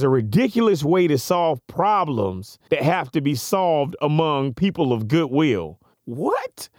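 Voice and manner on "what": incredulous. Real, not acted. Mock disbelief at quote.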